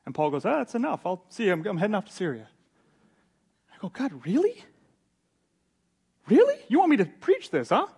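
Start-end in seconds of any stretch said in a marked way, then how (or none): 0.4-2.5 mock fed-up announcement, starting high and loud; fed-up quitting. Preacher acts out Paul quitting for Syria, mock fed up. 3.9-7.9 high, loud, disbelieving questions; mock disbelief, pitched right up; incredulous protest. Preacher questioning God over the passage to preach, incredulous.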